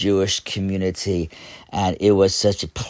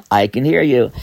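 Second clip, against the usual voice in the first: ordinary talk, nothing marked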